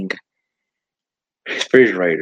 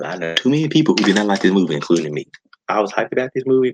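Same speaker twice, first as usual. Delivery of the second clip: ordinary talk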